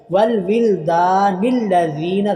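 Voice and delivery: slow chanted recitation, हा held long; Quran recitation. Shown as a demonstration. Teacher reciting Quran for students, slow drawn-out chant. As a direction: recite slowly, stretch the हा. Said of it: "दाल के नीचे ज़ेर आ गया".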